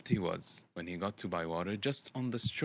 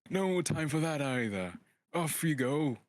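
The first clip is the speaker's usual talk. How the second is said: higher, louder brisk wizard; wizard shooing; 6 semitones higher, 4 dB louder than usual. Acted. Gandalf sending Bilbo running, brisk and loud. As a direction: shoo the hobbit out, high and loud.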